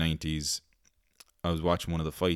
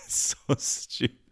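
high, slow words squeezed through real laughter; helpless laughter. Real, not acted. Host cracks up at own impression, laughing helplessly. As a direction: barely get it out for laughing.

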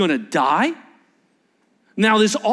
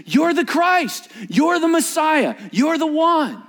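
much higher, slow and emphatic; exultant declaration; 10 semitones higher, 3 dB louder, slower than usual. Retold or imitated. Disciple declaring who Jesus is, exultant. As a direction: proclaim it with total conviction, drawing out each title. Peter's confession, acted out.

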